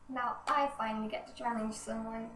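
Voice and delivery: usual speaking voice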